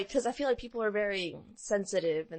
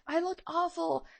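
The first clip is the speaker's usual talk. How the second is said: high, slower mock whine about the dress; whiny bride; 11 semitones higher than usual. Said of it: "she was like".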